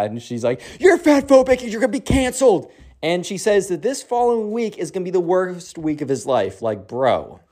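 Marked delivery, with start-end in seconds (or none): 0.8-2.7 high-pitched imitated scolding; mock outraged accusation. TikTok girl condemning Carter, mock outraged. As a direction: mimic a shrieky accuser.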